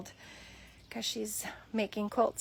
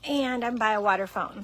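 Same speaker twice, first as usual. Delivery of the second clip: ordinary talk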